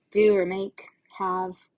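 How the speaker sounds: ordinary delivery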